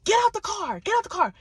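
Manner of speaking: high, loud barked order; furious woman yelling; rowdy shouting. Retold or imitated. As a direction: shout him out of the car. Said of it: "But then she gets rowdy".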